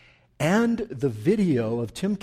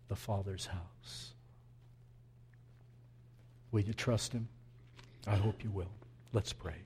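quiet preaching; 8 semitones lower, 8 dB quieter than usual. Acted. Pastor pleads softly with the congregation, hushed. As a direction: draw them in gently, almost hushed.

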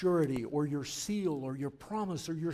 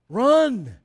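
a sudden loud cry, higher; urgent shout; 7 semitones higher, 15 dB louder than usual. Acted. Pastor urges children at communion with a loud shout. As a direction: call it out like a command to run. Urging the little ones to run.

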